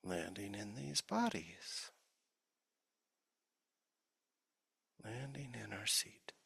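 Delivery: soft, unhurried meditation voice with long pauses; guided meditation. Real, not acted. Teacher leading the sangha's formal practice, soft guided meditation. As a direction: ease listeners gently into stillness.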